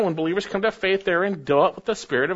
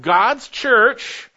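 emphatic; 6 semitones higher, 6 dB louder than usual. Acted. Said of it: "emphatic gloss".

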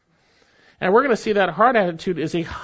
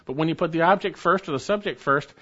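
normal talking voice

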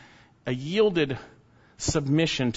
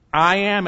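"I am" said louder and higher, both words hit hard; emphatic I am; 6 semitones higher, 7 dB louder than usual. Shown as a demonstration. Preacher stresses "I am" to the congregation, loud and emphatic. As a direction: lean hard on "I am" to show it is emphatic. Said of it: "It's emphatic".

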